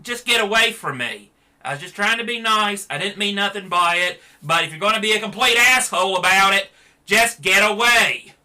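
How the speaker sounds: high, loud fed-up pushback; offended bystander. Retold or imitated. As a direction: shove the rude guy off, exasperated. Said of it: "People would ... be like".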